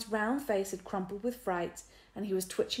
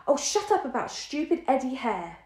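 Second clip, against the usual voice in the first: higher, louder irritated snap; snapping; 6 semitones higher, 6 dB louder than usual. A narrator's reading. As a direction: snap at him to drop it. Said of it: "snapped Dinah".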